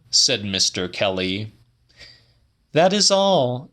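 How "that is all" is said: voiced much higher as the woman; Celia's voice. A narrator's reading. Woman telling the old man her news, higher voice. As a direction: lift the pitch well up for her lines.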